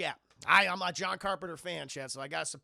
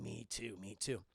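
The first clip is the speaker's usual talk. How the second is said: much lower, much softer mournful murmur; mock sad; 11 semitones lower, 9 dB quieter than usual. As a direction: say it quietly, resigned. Sad quiet agreement.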